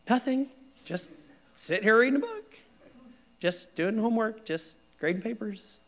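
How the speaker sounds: mock breezy wife. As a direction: play the wife saying nothing's wrong, airily. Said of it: "my wife".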